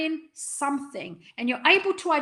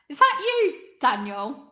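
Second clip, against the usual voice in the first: mock accusation; 2 semitones higher, 3 dB louder than usual. Speaker catching out the writer of a teasing comment, mock accusing. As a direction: call out the culprit in mock outrage.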